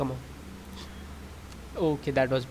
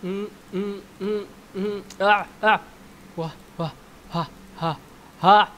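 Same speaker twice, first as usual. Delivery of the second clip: high straining grunts and gasps; struggling with the game; effortful grunts; 5 semitones higher, 6 dB louder than usual. Real, not acted. Straining through aim game.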